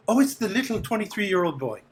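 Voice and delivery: high-pitched lady character, cooing; fawning lady of the house. Acted. Lady to the young man passing, fawning greeting.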